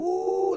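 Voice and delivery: very high, drawn-out ooh; excited "ooh". Retold or imitated. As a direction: reenact a thrilled reader's squeal. Reenacting excited reaction to threat.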